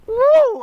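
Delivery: loud, very high woo; retold whoop. Thief speeding right past Roy, loud high whoop. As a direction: let out a big falsetto-high whoop.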